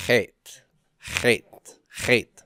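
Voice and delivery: "Chet" as "khet", rasped in the throat; rough guttural consonant. Shown as a demonstration. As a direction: begin "Chet" with a German-style throaty KH. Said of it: "this is a rough guttural consonant".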